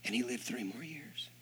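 hushed whisper for the punchline; whispered reveal. Real, not acted. Preacher telling the miracle, whispered. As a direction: drop to a whisper to let it land. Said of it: "dramatic hushed reveal".

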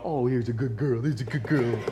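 cooing like to a pet dog; doggy talk to wife. Retold or imitated. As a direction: coo at her like she's the dog. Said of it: "you go".

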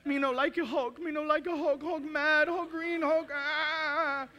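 put-on high, whiny little child voice; scared toddler. Dad imitating his daughter waking up scared at night, whiny. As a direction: whimper like a frightened three-year-old.

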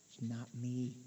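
soft and low, almost to oneself; hushed inner refusal. Shown as a demonstration. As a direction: murmur it inward, quietly. Repeated declaration, first take.

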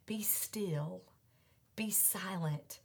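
slow, hushed whisper. Acted. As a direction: breathe the command softly. God's command whispered.

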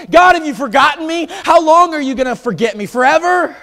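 high, loud anguished cry to God. Retold or imitated. David crying out to God, loud anguished plea. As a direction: wail the question up to heaven. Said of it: "he cries out".

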